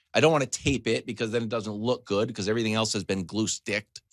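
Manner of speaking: normal talking voice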